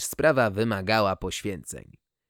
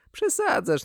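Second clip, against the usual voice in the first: exasperated. Acted. Scolding the other owner.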